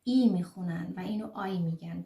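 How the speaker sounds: ordinary talk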